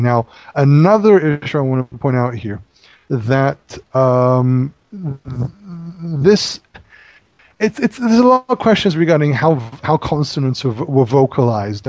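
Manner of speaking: ordinary talk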